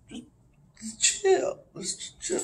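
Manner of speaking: high voice fading to a whisper; softly acted stoned calm; mellowed-out stoner. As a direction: trail off into a faint whisper. Stoned character calming others, fading.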